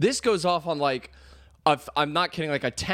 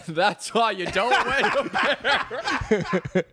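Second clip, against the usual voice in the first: laughing mock yell; 7 semitones higher, much slower than usual. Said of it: "one of us would yell out".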